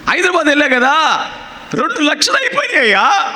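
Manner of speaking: high, rising wail about the money spent; complaining woman caller. Retold or imitated. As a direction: imitate the lament of a worried woman. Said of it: "బాధపడిపోతుంది".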